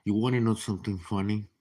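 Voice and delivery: usual speaking voice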